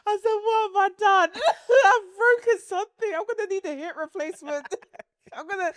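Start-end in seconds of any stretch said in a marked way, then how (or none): 0.3-4.7 high-pitched mock-crying lament; tearful wail. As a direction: wail it half laughing. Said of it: "I cried".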